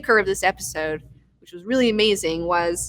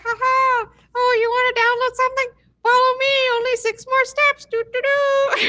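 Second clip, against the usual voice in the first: hammed-up squeaky cartoon helper, much higher; cartoon paperclip voice. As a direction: play a silly chirpy paperclip mascot. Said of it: "He's like".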